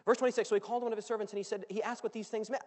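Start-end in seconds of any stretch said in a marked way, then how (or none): none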